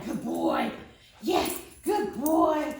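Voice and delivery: put-on slow praising good boy, rising to high; drawn-out, then lifted praise. Shown as a demonstration. Trainer praising her dog during tug, warm. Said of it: "I'll use commands like good boy".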